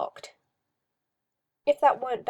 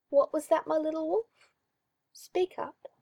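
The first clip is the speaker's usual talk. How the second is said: a hint of a creepy, cooing master; mildly sinister coaxing; lightly voiced oily magister; 6 semitones higher than usual. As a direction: purr it like a condescending owner. Magister taunting.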